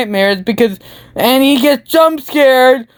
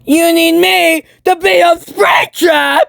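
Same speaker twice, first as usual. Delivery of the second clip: higher, slow disbelieving yell; incredulous shout; 8 semitones higher than usual. Retold or imitated. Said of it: "Markiplier's like".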